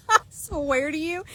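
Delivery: high voice squeezed by laughter; laughing. Real, not acted. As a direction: talk through the tail of a laughing fit. Talking through her laughter.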